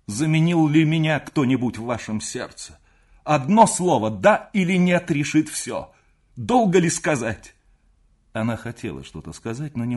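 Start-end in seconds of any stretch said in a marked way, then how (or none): 0.1-7.5 loud, raised, impassioned questioning; insistent demand. A narrator's reading. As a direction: press hard for an answer, higher and louder. Lover demands a yes or no. (настойчиво, громко и страстно)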